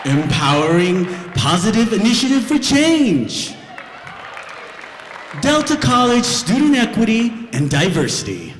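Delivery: polished, proper emcee read; formal announcer voice. Acted. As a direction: welcome the crowd like a stiff host.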